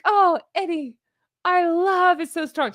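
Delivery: high, swooning gush over a love letter. Pretend. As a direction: pour on the fake adoration. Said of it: "I'll be like".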